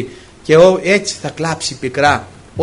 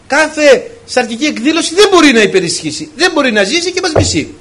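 higher and louder, driving each phrase home; rising sermon climax, loud and high; fiery preaching; 7 semitones higher, 5 dB louder than usual. Acted. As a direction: preach it at full force to the congregation. Preaching builds to full force.

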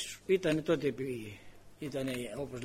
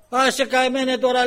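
loud, high dismissive scold; scolding shout; 9 semitones higher, 13 dB louder than usual. Retold or imitated. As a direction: wave him off with a loud scold. Official scolds the speaker.

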